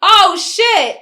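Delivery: loud, high cry of alarm; panicked realization. Retold or imitated. Traveler realizes the duffel is missing, loud alarm. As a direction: blurt it out in sudden horror. Acts realizing bag was left behind.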